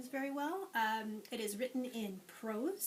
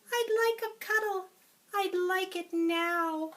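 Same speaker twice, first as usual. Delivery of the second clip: much higher, louder sweet cat; 9 semitones higher, 10 dB louder than usual. Acted. Teacher voicing the cat for the class, much higher and louder. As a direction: coax for a cuddle as the cat. Cat character quote in story.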